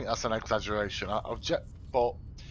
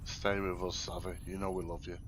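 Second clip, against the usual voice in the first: soft, lower, fond murmur; tender to the cat; 5 semitones lower, 8 dB quieter than usual. Real, not acted. Speaking softly to his cat Savvy, tender and reassuring. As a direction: coax the cat gently, quieter.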